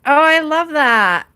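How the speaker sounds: high and loud, delighted. Real, not acted. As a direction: gush with delight. Delighted at a card.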